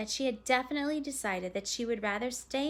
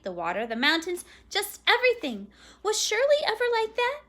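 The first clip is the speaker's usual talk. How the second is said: higher, louder venting; exasperated mother; 7 semitones higher, 9 dB louder than usual. A narrator's reading. Mother venting to her friend about her daughter, exasperated. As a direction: list the fears in fed-up bursts.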